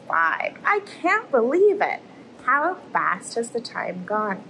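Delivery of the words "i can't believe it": bright, thrilled exclamation; excited. Real, not acted. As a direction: burst out with delight. Host thrilled at day five.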